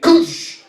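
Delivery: imitated slamming gate, high whooshing burst; gate slam noise. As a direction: make the crash of a baby gate.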